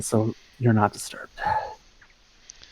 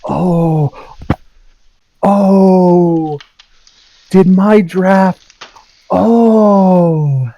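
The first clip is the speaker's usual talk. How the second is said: higher, louder, broken-up exclamations; stunned; 8 semitones higher, 7 dB louder, much slower than usual. Acted. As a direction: reel from the news, half choked up. Shock at sister's news.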